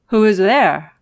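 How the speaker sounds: loud, booming wolf; big wolf voice. Acted. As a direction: boom it from the bed like a big wolf. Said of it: "the big voice of the wolf".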